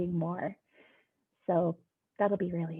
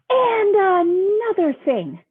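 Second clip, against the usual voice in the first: high, loud, slow, wailing outburst; furious pigeon; 10 semitones higher, 10 dB louder, much slower than usual. Acted. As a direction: blow up at the duckling. Pigeon's climax rant.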